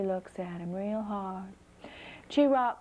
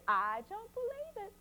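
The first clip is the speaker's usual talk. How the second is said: put-on skeptical little girl; 12 semitones higher than usual. A narrator's reading. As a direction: stretch it out high, not fooled one bit. Flossie doubts fox.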